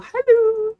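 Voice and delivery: high, loud bright greeting; chirpy hello. Real, not acted. Cashier greeting a customer, high and bright. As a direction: welcome the customer sweetly.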